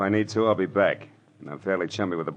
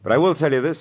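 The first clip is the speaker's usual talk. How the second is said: higher and louder, pressing hard; sharp emphasis; 11 semitones higher, 8 dB louder, faster than usual. Acted. Detective pushing the suspect, higher and louder. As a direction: lean in hard on it.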